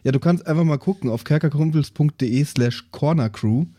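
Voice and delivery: normal talking voice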